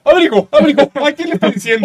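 high, loud puzzled quote; hammed-up confused yell; mock baffled shout. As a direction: shout the question like a bewildered kid. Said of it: "Y me pregunta".

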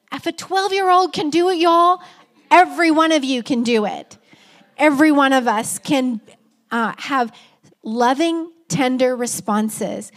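rally the whole room to act; exhorting. Real, not acted. Preacher exhorting congregation.

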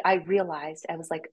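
ordinary talk, nothing marked

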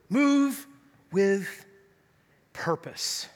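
spaced word by word, sinking to a hush; emphatic refrain. Real, not acted. Repeats the message for effect.

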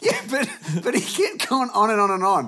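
high, loud, laughing through the words; can't stop laughing while telling it; laughing about dad's nagging. Real, not acted. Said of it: "laughing retelling".

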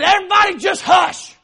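fed-up yell to the whole car; screaming. Retold or imitated. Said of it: "I'm screaming back at her".

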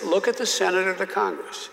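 usual speaking voice

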